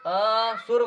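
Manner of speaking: असुर with a long, separate अ and then सुर; split word. Shown as a demonstration. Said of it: "अ को अलग करके बोल दिया जाए". (असुर में अ को खींचकर सुर से अलग बोलें)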